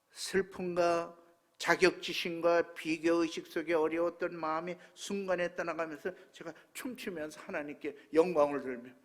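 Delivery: ordinary talk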